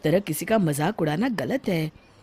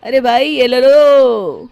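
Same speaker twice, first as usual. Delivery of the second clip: loud, high, drawn-out vendor cry; street hawker call; 6 semitones higher, 13 dB louder, slower than usual. Acted. Comb seller calling to passersby, loud slow hawker cry. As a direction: sing out your wares to the street.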